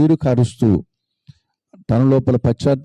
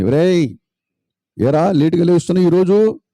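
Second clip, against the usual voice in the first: calling sister; 7 semitones higher than usual. Acted. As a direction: call him out of bed. Sister calls the late brother.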